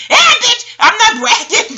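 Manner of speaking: very high, loud laughing shriek; laughing brag. Real, not acted. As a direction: crow it through a laugh. Laughing brag about bad evaluation.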